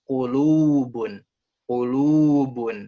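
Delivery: ku-LUUU-bun, slow and loud on the middle; stress on long wau. Shown as a demonstration. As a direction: swell and hold "lu" in Kulubun. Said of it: "kalau yang wau".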